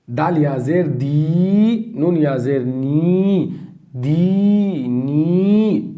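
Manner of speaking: slow spelling with long दी and नी; slow drawn spelling; lengthened ya madda. Shown as a demonstration. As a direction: stretch दी and नी by one alif. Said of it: "इसमें भी या मद्दा होगा".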